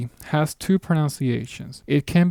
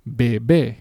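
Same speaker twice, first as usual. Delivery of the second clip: bebé with a forceful last "bé"; stress on final syllable; 3 dB louder than usual. Shown as a demonstration. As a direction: land hard on the end of bebé. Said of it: "if I add the accent mark".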